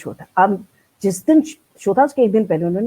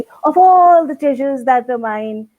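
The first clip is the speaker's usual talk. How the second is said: very slow, lilting, acted-out children's poem; expressive poem recital; 5 semitones higher, 4 dB louder, much slower than usual. Shown as a demonstration. A little girl acting out her poem for the teacher, very slow and lilting. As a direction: tell the poem with feeling, drawing out each line. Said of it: "तो इस तरह से मैं बोलती".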